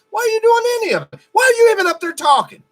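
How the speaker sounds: high, fast, furious yelling; angry shout. Real, not acted. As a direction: blow up at her, shouting. Ranting at the politician.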